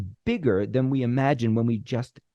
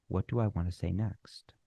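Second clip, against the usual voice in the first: whisper; 5 semitones lower, 8 dB quieter than usual. Shown as a demonstration. Imagined thought while pausing.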